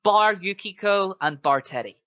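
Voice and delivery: normal talking voice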